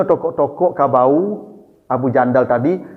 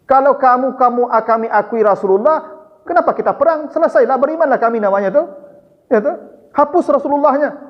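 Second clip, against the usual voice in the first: higher, quicker voice for the objecting envoy; Suhel objecting; 7 semitones higher than usual. Retold or imitated. Suhel the Quraysh envoy protesting to the Prophet, higher and hurried. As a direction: press the objection fast and a bit higher.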